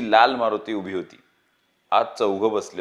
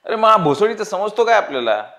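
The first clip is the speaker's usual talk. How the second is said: higher, louder furious outburst; angry shout; 10 semitones higher, 7 dB louder than usual. A narrator's reading. Dawood's friend yelling at Gopi in a heated argument, furious shout. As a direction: snap back at the gang in full anger. Friends quarrel over the robbery.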